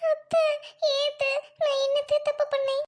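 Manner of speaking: high, pained protest; hurt plea. Acted. Daughter-in-law asking mother-in-law what she did wrong.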